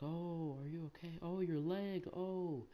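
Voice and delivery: high, soft mock-concerned coach voice; imitated coach's anxious cooing; worried coach. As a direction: fuss over a hurt kid in a high voice. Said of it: "My coach comes over there, he's like".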